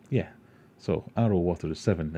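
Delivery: ordinary delivery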